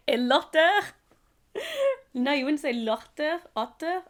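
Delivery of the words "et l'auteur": high giggle then word; real laughter breaking in; giggling. Real, not acted. Giggling at own French.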